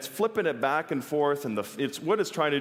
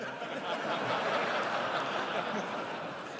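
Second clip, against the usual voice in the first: laugh; 6 semitones higher, 8 dB quieter than usual. Real, not acted. Laughs at his own joke.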